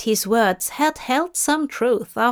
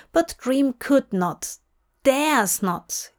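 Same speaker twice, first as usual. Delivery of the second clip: plain reading voice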